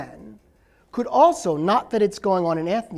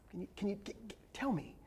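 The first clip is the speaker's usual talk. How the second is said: whispered plea; 18 dB quieter, faster than usual. Retold or imitated. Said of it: "whites in the audience who will".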